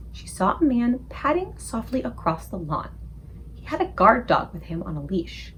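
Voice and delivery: plain reading voice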